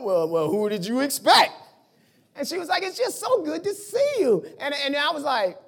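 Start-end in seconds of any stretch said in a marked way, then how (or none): 0.0-1.5 imitated comeback, jumping up on the last word; mock indignant retort. Husband answering wife at the door, mock indignant. Retelling his reply to wife. 2.8-4.4 high, cheery wife voice; imitating cheerful wife. Wife welcoming husband home, imitating her cheer. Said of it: "she just looked cheerful".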